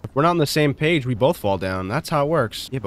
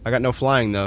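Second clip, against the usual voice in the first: ordinary talk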